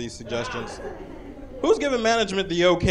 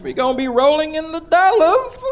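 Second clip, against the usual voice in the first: boastful coworker; 13 semitones higher, 6 dB louder than usual. Retold or imitated.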